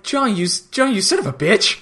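high-pitched indignant cursing, played for laughs; hammed-up exasperated outburst; mock exasperation. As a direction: curse him out in mock outrage. Voices Aaron cursing John after coin.

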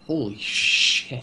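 slow, drawn-out imitated astonishment; stunned mock reaction. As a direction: stretch it out as if floored, acted. Acts her stunned reaction, drawn out.

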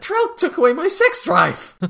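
very high, put-on wail; mock outraged squeal. Chiana blaming Trell after the kiss, high mock wail. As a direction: squeal it in hammed-up dismay.

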